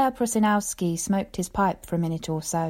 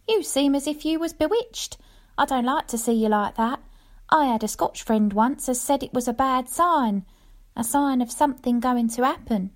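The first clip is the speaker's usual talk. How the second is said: higher anxious wife's voice; worried little wife; 6 semitones higher than usual. Acted. Wife warning her giddy husband, higher worried voice. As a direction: fret gently in a higher woman's voice. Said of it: "says my little woman".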